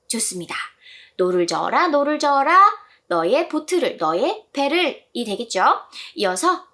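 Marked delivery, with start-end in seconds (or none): none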